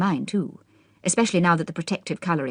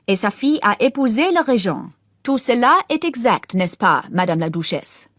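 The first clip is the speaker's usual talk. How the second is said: higher, showy French lecture; showy French recital; 5 semitones higher, 4 dB louder than usual. A narrator's reading. Boy lecturing the Duchess in showy French. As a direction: perform your learning for the Duchess.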